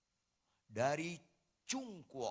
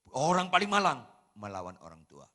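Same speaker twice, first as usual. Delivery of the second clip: punched line; 12 dB louder, faster than usual. Real, not acted. Preacher punches key line.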